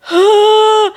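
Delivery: loud high mock sob gasp; imitated sob gasp. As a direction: gasp like a crying bride. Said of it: "The sobbing is coming".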